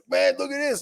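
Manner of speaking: imitating a hyped show-off, very high; high-pitched mock frenzy; going crazy. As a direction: show it off in a high, excited dude voice. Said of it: "he was, like, going crazy".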